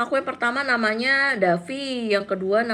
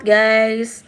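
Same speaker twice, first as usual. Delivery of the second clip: normal talking voice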